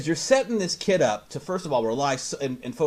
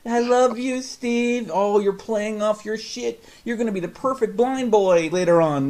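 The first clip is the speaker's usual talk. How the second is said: high, loud mock sobbing gush; fake-sob like a weepy fan; mock crying; 7 semitones higher, 4 dB louder, slower than usual. Mocking a viewer crying over the blind boy.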